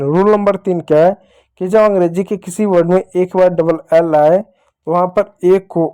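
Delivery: normal talking voice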